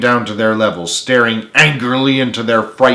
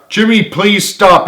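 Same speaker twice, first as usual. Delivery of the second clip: slow, raised begging; pleading; 9 semitones higher, 5 dB louder, slower than usual. Acted. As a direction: plead with him slowly. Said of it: "Eddie pleaded".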